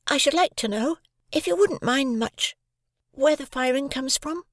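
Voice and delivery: put-on politely, a small boy; young boy Pip. A narrator's reading. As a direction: Pip addresses his sister, high and timid.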